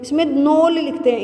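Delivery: ordinary delivery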